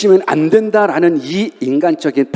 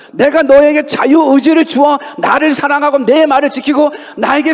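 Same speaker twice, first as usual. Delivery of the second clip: lamenting God; 8 semitones higher than usual. Retold or imitated. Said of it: "애통해 하시면서".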